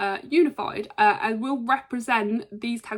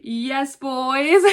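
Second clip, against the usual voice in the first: higher, louder gleeful yell; excited cheer; 4 semitones higher, 6 dB louder than usual. Real, not acted. Cheering on Pretty Deadly.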